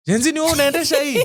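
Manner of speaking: high, rapid incredulous question; quick, high-pitched quoted challenge; acted watchman's question. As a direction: fire off the question fast and high, imitating a watchman. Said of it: "This guy's like".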